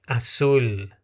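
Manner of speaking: "Azul" said with S and a clear final L; Spanish azul with S. Shown as a demonstration. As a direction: say "Azul" as "asul" with the tongue on the palate. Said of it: "som de S". ("Azul" com S e L no palato)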